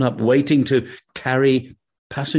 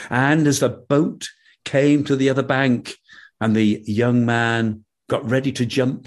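ordinary delivery

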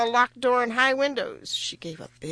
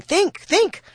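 high, loud, urgent urging; crying out; 11 semitones higher, 8 dB louder than usual. A narrator's reading. King presses the girl to remember, crying out. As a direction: cry it out as the eager king. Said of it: "cried the king".

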